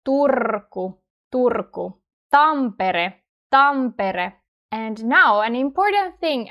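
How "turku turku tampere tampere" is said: "Turku" and "Tampere" with trilled Finnish R; rolled R. Shown as a demonstration. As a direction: roll each r in "Turku", "Tampere". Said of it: "we roll our R's".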